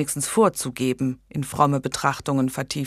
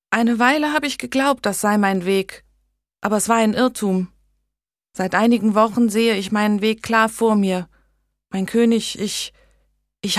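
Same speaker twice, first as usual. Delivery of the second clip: a lightly voiced young woman, higher and unsure; timid young lady; 6 semitones higher, 4 dB louder than usual. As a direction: ask the king hesitantly, a little higher. Megan explains to the king.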